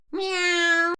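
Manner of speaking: loud high meow imitation; mock cat meow. Cat sound.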